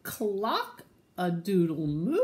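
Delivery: slow, loud, questioning cow; cow's crow. Acted. Cow still guessing the crow, slow and loud. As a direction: drawl it loudly, ending on a question. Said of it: "the cow said".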